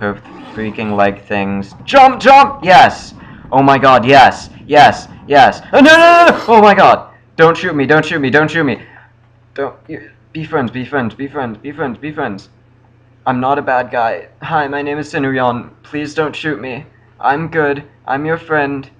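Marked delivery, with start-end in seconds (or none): 1.8-7.1 high shrieks of joy then panic; screaming with triumph and alarm; excited yelling. Real, not acted. Jumps, cheers, then panic. 7.4-18.9 pleading. Real, not acted. Pleading with NPC.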